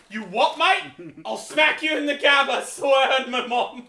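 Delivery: put-on loud, high, slow British accent threat; hammed-up British accent. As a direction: bark it like a cheeky British kid. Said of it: "this little British kid".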